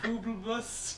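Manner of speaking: playful "Pooblebus" in oob speak; oob language. Shown as a demonstration. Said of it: "replace every vowel with the wo-, with oob".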